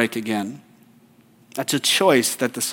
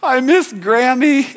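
drawn-out, much higher mock child wail, slow and louder; imitating crying child; 11 semitones higher, 6 dB louder, slower than usual. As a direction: echo a weepy kid leaving grandma's house. Kids weeping leaving grandparents.

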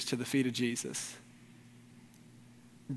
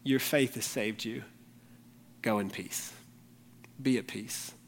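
soft, gentle, much quieter, as Jesus would say it; tender voice of Jesus; 4 semitones lower, 7 dB quieter than usual. Acted. Pastor voices Jesus blessing the repentant woman, soft and tender. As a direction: hush down and give the blessing tenderly. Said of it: "And hear him say".

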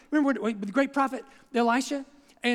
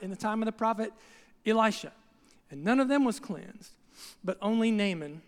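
scripture reading; 5 semitones lower than usual. Acted. Reads scripture aloud.

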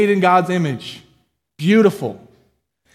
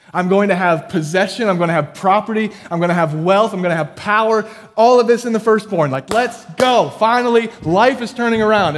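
rising louder and higher, grabbing for more; greedy excitement; 6 semitones higher, 5 dB louder than usual. Retold or imitated. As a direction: build like a man sure his luck has turned. Preacher voicing Cain's greedy hopes.

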